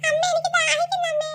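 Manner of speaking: put-on high child voice whining to mum; a thirsty little kid pleading; child asking mother for water; whiny child.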